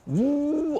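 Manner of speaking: high, drawn-out "woo"; playful whoop. Retold or imitated. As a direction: whoop lightly as if on a ride. Re-enacts enjoying the roller coaster ride.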